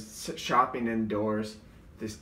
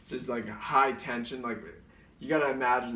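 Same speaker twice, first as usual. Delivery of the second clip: ordinary delivery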